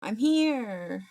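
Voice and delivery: ordinary delivery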